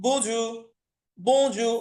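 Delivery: BONJOUR with the first vowel through the nose; French nasal vowel in বজু. Shown as a demonstration. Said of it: "একটু নাকের ভিতরে নিবেন".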